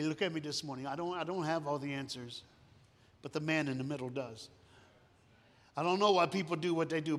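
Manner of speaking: normal talking voice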